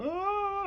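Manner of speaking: sudden high mock wail; a quick hammed-up wailing cry. Shown as a demonstration. Showing the wailing he didn't do, a high mock wail. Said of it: "I wasn't sobbing. I wasn't wailing".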